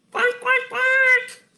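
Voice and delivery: high duck quacks, last one loudest; squawking cartoon duck finale; excited quacking. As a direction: quack high like a flock of ducks. Three ducks quack at ending.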